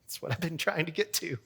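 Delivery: the happy rush of finally fixing it; relieved excitement. Retold or imitated. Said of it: "I'm like".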